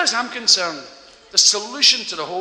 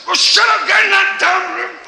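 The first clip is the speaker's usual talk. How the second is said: much higher, louder angry shouting; yelling father; 12 semitones higher, 7 dB louder than usual. Acted. Father yelling at his kids behind door, angry shout. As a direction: bellow at the noisy kids.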